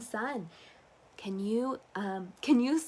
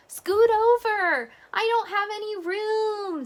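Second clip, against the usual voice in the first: duck voice; 12 semitones higher, 7 dB louder than usual. Acted. Duck's cramped complaint.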